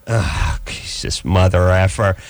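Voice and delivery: ordinary delivery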